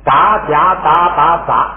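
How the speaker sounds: unaspirated sounds said slowly and clearly, 杂夹杂杂杂; drilling the unaspirated letters; slow teaching demo. Shown as a demonstration. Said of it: "哎，变成".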